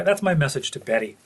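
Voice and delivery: ordinary talk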